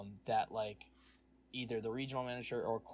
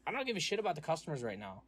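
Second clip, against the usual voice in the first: fast, higher, heated retort to the boss; retold angry retort; 6 semitones higher, faster than usual. Telling off the regional manager, fast heated retort. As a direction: fire it back, fed up and fast.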